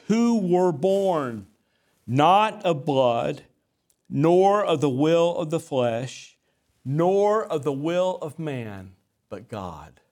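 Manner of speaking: solemn scripture reading. Acted. Reads scripture verse.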